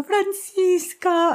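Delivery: high, slow drawn-out name; stern mom calling. Retold or imitated. Mother finding out, calling her full name slowly. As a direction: stretch the name out like a shocked mom.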